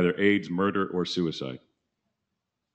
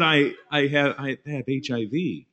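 higher, louder put-on voice at first; imitated friend confessing; 8 semitones higher, 5 dB louder than usual. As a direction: stammer it in the friend's higher comic voice.

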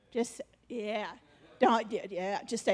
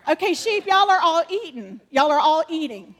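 raised, higher voice calling to the sheep; calling out loudly; 4 semitones higher, 9 dB louder than usual. Real, not acted. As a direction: project loud across the room like directing a crowd. Directing sheep actors in skit.

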